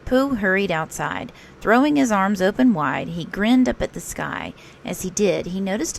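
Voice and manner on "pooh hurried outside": plain reading voice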